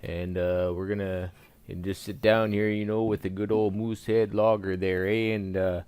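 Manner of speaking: hammed-up Canadian accent; thick comic Canadian accent. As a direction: play it as a folksy Canadian with a sing-song "eh".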